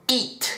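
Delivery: eat with a crisp, dry Spanish i; dry long ee. Shown as a demonstration. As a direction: say eat with a clean, tense ee. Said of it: "Se pronuncia con una I seca".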